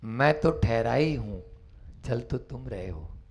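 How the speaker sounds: low, soft and serene; calm monk's reply. Acted. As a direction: answer with quiet composure. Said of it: "सामने से आवाज आती है".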